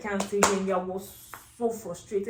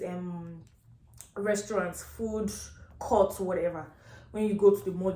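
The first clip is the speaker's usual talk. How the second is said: ordinary talk, nothing marked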